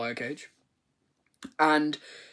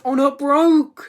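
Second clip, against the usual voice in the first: higher, louder, slow dismay; hammed-up dismay; mock panic; 10 semitones higher, 8 dB louder than usual. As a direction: wail over the broken saw.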